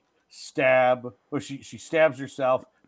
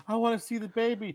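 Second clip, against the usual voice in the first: high-pitched put-on mother's voice; imitated mother; 9 semitones higher than usual. Retelling the mother asking to see the baby, mock pleading.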